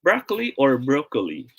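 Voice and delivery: "broccoli" as BRAH-klee, then BROH-kuh-lee; open-O "broccoli", then long-O "broccoli"; two pronunciations contrasted. Shown as a demonstration. Teacher quizzes viewers, contrasting broccoli pronunciations. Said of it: "how should we pronounce this word".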